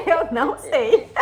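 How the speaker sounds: laughing through the words; amused, helpless laugh around the words; laughing admission. Real, not acted. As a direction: say it through giggles. Admits not knowing, laughing.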